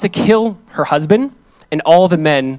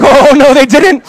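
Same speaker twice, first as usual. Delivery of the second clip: loud, high acted contradiction; indignant retort; 9 semitones higher, 8 dB louder, slower than usual. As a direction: shoot down the lie, voice raised. Retorts to the lying thief.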